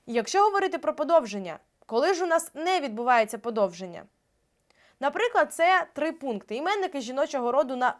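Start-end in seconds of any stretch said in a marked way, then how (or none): none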